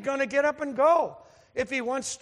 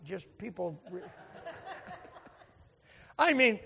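usual speaking voice

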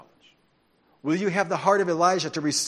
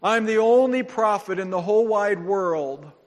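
crying out to God; 4 semitones higher, 5 dB louder than usual. Retold or imitated. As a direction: cry out to God in despair. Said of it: "and he's crying".

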